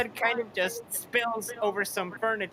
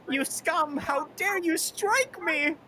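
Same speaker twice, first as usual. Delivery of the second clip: higher, slow, wounded indignation; mock crying villain; 8 semitones higher, slower than usual. Beaten Dio whining at Jonathan in mock tears. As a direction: whine it out like a sore loser. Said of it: "Dio starts crying, and he's like".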